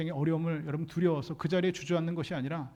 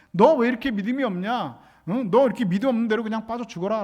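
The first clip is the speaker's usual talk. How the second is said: mock scolding; 4 semitones higher, 6 dB louder than usual. 꾸짖는 주님 가정.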